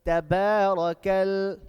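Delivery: slow, loud recitation with stretched vowels; measured, sustained tajweed delivery; loud Quran recitation. Shown as a demonstration. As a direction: chant it out word by word for a class to repeat. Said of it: "going to do it together".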